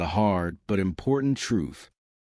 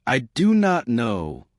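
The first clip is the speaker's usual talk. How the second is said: slow, every word in full; deliberate "do not", no contraction; careful learner version; 3 semitones higher than usual. Shown as a demonstration. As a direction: spell out each word separately. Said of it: "Learners say".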